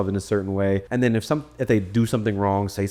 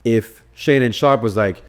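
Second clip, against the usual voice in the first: ordinary delivery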